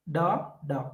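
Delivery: da, da, both beats soft and light; unstressed demo. Shown as a demonstration. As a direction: keep both beats weak and quiet. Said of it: "don't take the stress".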